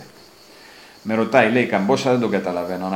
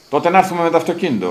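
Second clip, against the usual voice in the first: higher and fast, να stressed; pleased giant; 8 semitones higher, 6 dB louder, faster than usual. Retold or imitated. Giant happy the traveller agrees, quick and pleased. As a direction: glad he finally gave in. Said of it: "χαμογελάει ευχαριστημένος".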